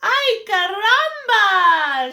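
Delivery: high, loud, slow Spanish-flavoured squawk; a hen cries out in delight; chicken crying out. Acted. Chicken spots the nest and cries out in delight. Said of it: "she cried".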